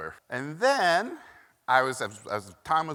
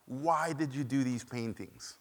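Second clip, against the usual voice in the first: put-on slow, softer Hungarian-accented question; 7 dB quieter, slower than usual. Hungarian mentor asking student about paintings, slow soft accent. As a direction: ask gently and deliberately, Hungarian accent. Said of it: "thick accent".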